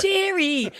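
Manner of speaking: high, playful [name] with the vowel of chair; self-mocking "chair-ee". Shown as a demonstration. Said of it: "I'm like".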